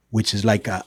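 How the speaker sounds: ordinary delivery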